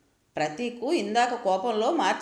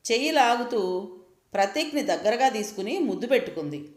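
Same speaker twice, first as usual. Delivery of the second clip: plain reading voice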